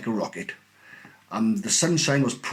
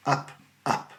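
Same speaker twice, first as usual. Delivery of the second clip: ordinary delivery, nothing marked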